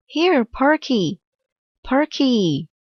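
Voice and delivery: high, anxious calling for a lost dog; boy searching for his dog, calling high. A narrator's reading. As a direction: call her across the yard. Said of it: "called Arthur".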